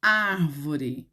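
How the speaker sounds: "Árvore" said slowly with weight on "Ár"; deliberate, careful "Árvore" for the class; stressed first syllable. Shown as a demonstration. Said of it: "Qual é a, a sílaba forte dela?".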